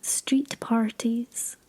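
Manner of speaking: usual speaking voice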